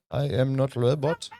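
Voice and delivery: usual speaking voice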